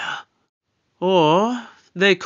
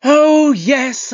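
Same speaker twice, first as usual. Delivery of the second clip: loud, high, emphatic "oh"; emphatic exclamation; 6 semitones higher, 8 dB louder than usual. Acted. Doctor brushing off his companion's protest, emphatic exclamation. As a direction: burst in, louder and higher.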